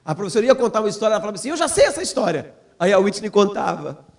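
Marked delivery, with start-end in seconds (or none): 1.5-2.5 high-pitched daughter piping up; imitated eager child. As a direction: jump in like a child who knows it. Voices daughter interrupting the teacher.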